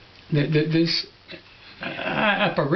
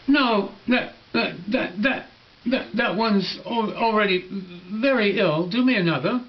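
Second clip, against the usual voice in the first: put-on higher, stammering childlike refusal; little prince; 5 semitones higher than usual. Little prince turning down the sick sheep, higher childlike voice. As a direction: reject it like a fussy small boy.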